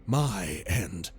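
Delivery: usual speaking voice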